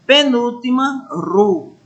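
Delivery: normal talking voice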